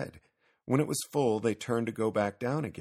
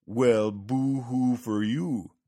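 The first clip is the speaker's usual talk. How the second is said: slow, louder sarcastic pity; mock sympathy; 3 semitones higher, 6 dB louder, much slower than usual. A narrator's reading. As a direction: drag it out to tease the whiner. Old man mocks the complaining cat.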